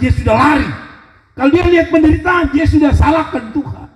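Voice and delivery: a preacher shouting his point to the church; fiery preaching. Acted. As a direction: preach it out with rising fire. Preacher builds to climax.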